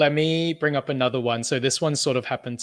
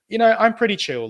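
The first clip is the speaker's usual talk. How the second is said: high, louder quoting of a laid-back friend; friend's voice; 8 semitones higher, 5 dB louder than usual. Retold or imitated. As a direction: act out the friend's answer. Friend quoted.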